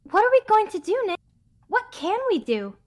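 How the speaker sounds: high, anxious character voice; high-pitched acted dismay; worried sidekick.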